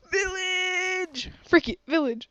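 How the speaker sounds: thrilled discovery shout; excited cry. Real, not acted. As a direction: call out the find with joy. Spots a village.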